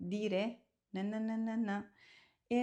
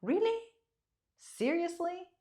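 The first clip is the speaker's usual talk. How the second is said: incredulous; 9 semitones higher than usual. Shown as a demonstration. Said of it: "which mean".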